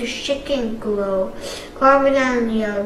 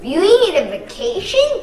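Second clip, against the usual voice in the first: high, louder exasperated question; frustrated; 8 semitones higher, 5 dB louder than usual. Acted. Kai asking Jay, frustrated, high and louder. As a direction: snap it at him, fed up. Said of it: "Kai asked, frustrated".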